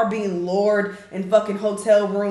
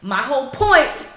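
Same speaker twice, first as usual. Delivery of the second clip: normal talking voice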